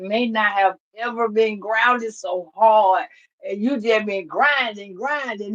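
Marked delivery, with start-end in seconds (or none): none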